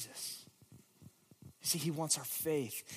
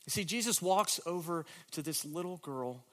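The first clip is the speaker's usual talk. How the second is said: normal talking voice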